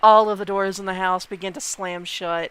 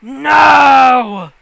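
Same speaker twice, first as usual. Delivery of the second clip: huge, drawn-out high bellow; long, full-force shouted refusal; ghostly shout; 6 semitones higher, 17 dB louder than usual. Acted. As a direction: roar it out like a furious house. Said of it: "you hear".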